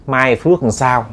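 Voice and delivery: ordinary delivery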